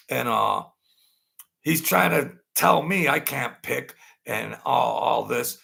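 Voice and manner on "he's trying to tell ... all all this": ordinary delivery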